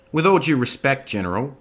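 sharp interjection. Acted. Officer cutting in on the general, sharp and loud. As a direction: cut in hard. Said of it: "Lisa Irving interjected".

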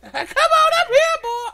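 put-on loud, very high, drawn-out girl voice; hammed-up high female caricature; ugly girl voice. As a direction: squeal it as a comically ugly girl. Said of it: "ugly girl voice".

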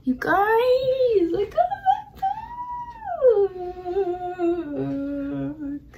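high, slow, laughing with joy; thrilled. Real, not acted. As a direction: burst out with giddy joy. Thrilled about new MacBook.

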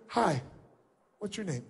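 put-on soft, timid greeting like a frightened child; scared kid. Shown as a demonstration. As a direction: shrink away, barely daring to speak. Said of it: "like a little kid shows their fears".

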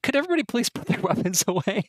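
high, quick pleading voice; high-voiced plea for calm, laugh breaking in; Marta. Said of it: "Marta is like".

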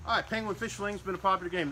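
normal talking voice

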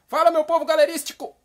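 high, loud, lively catchphrase; animated demo greeting. Shown as a demonstration. Host shows Carlos how to greet the viewers, animated shout. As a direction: belt it out like a hyped host. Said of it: "Agora fala assim, ó".

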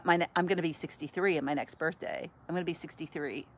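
usual speaking voice

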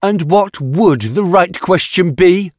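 high, challenging retort; rounding on someone. A narrator's reading. Yatena turns on the man who questioned her, challenging. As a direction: throw it back, pitched high. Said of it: "Yatena rounded on him".

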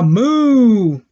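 long, loud imitated moo; drawn-out teacher's moo; cow moo. Shown as a demonstration. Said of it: "what does a cow say".

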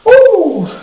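loud high woo of relief; relieved cheer. Real, not acted. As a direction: let out a big whoop. Relief after finishing set.

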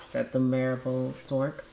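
ordinary delivery, nothing marked

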